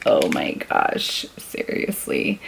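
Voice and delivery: slow, breathy whisper of amazement; whispered awe at a bright pink; awed whisper. Real, not acted. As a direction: hush it like it's too pretty.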